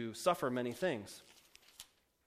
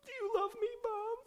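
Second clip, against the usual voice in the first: very high, whiny pretend child voice; whiny kid Jesus; 4 dB louder than usual. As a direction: play a hurt twelve-year-old whining at his parents. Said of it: "come back at their rebuke with his own rebuke".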